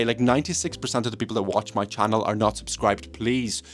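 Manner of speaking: ordinary delivery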